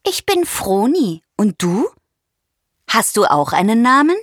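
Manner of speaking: a hint of a chirpy bird; lightly voiced curious little bird. A narrator's reading. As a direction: introduce yourself as an inquisitive bird. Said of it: "Neugierig schaut er die Giraffe an und stellt sich vor".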